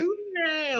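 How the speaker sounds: high sustained mock "ah"; mock dreamy "ah". Speaker mocking the cosy Noah story, high drawn-out "ah". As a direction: hold a high, airy note in mockery. Mimicking a sweet idea of Noah.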